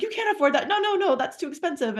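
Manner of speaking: a high, flustered "no, no, no" from the sister; protesting sister. Retold or imitated. Woman with no teeth refusing a costly gift, high mock protest. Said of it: "She's like".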